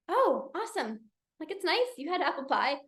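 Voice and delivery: soft, politely indifferent imitation; mild, underwhelmed congratulations; unimpressed husband. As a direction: act out a husband who doesn't get it. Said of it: "did not get it".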